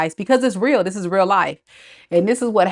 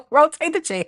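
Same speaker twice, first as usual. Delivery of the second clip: high, giggled words; laughing; 7 semitones higher than usual. Real, not acted. Host repeating Bree's funny line, laughing. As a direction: squeeze it out through laughter. Cracks up at the quote again.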